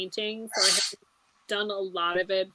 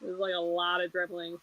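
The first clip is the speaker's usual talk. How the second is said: ordinary delivery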